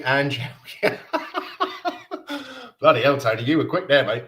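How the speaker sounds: ordinary talk, nothing marked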